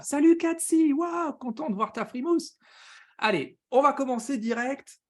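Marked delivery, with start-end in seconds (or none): 0.0-2.6 high-pitched delighted welcome, loud at the start; bursting hello, pitched way up; excited greeting. Real, not acted. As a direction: greet a friend you're thrilled to spot. Greeting a viewer who just joined.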